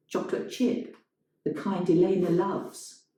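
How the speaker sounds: usual speaking voice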